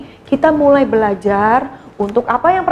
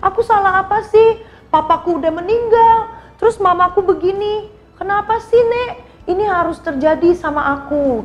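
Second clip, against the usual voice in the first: put-on whiny child Rita; 6 semitones higher than usual. Rita complaining to grandma, in a higher whiny child voice. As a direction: whine like a hurt little girl. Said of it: "Terus dia bilang".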